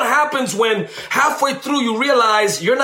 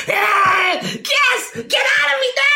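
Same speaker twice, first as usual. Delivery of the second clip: much higher, hammed-up yelling; mock zealous shout; 12 semitones higher than usual. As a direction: parody the storefront church zeal. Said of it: "I'm not talking about that".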